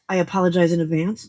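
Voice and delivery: ordinary talk, nothing marked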